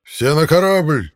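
loud, high, drawn-out call; shouted order. A narrator's reading. Fighter orders all men aboard, loud shout. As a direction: holler the command across the fight. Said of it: "крикнул драный плащ".

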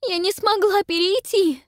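high, loud sobbing wail. A narrator's reading. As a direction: wail it through sobs. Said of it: "рыдает Пиппа".

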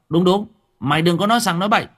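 loud, high, fast jeering; rowdy agreement. Acted. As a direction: pile on her like a mob. Gang chimes in. (đám du côn hùa theo, to và nhanh)

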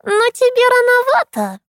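very high teasing crone; mock the boy like a crone. A narrator's reading.

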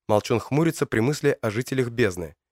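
usual speaking voice

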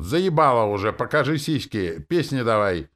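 loud, high heckles from a crowd; heckling shouts. A narrator's reading. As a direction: heckle the stage impatiently. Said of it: "Послышались выкрики". (громкие выкрики из толпы)

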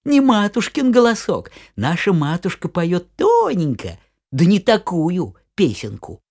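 high, loud little goats; squeaky children shouting back; kid goats voice. Acted. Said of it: "А козлята отвечают".

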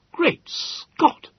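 high, breathy gasp of astonishment; astonished exclamation. Acted. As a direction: blurt it out in shock. Host exclaims.

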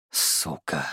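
low whispered sighing curse. A narrator's reading. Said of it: "вздохнул я шёпотом".

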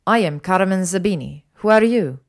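loud, indignant Italian woman; imperious retort with Italian accent. Acted. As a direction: fire it back in an Italian accent. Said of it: "she shot back".

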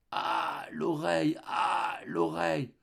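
soft, breath-filled "ah" moans; acted swooning lament; moaning wife. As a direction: sigh out the complaint about the ear. Wife moaning about her ear.

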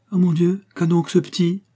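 high-pitched startled concern; alarmed exclamation. A narrator's reading. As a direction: cry out in surprise over a child. Startled relative at dinner table. (exclamation alarmée, voix haut perchée)

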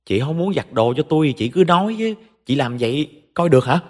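high-pitched, irate sister-in-law tirade; angry screeching. A narrator's reading. Sister-in-law scolds Hằng over her laundry, angry screeching. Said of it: "Nó tức giận ném thẳng vào mặt của Hằng rồi tru tréo".

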